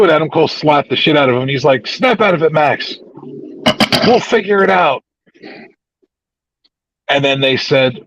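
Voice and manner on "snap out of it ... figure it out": high-pitched retold scolding; rally a despairing partner, acting it out; urgent imitated pep talk; mock firm rallying. Retelling Adam Cole after slapping partner.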